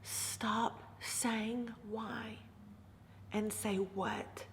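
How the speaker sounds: very soft, slow breathy whisper of advice; gentle whisper. Retold or imitated. Friend whispers gentle advice to the grieving speaker. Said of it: "leaned over as gently as he could".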